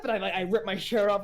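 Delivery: ordinary talk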